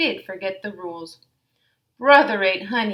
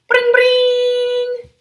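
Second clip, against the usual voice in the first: phone ring; 11 dB louder than usual. Acted. Phone rings in story.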